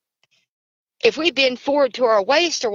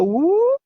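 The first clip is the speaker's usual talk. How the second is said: imitated whoop; 10 semitones higher than usual. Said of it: "what we can only describe as a whoop sound".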